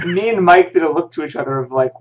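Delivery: usual speaking voice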